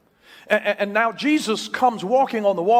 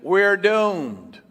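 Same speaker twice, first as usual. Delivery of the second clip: mock doom. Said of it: "When you panic, you".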